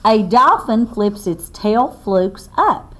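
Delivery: slow, loud read-aloud to a class; reading to children. Acted. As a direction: spell it out clearly for little listeners. Reads book text aloud.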